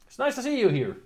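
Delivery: a hint of a toy's greeting; mascot voice. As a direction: let the stuffed mascot say hello. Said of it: "and they welcome you".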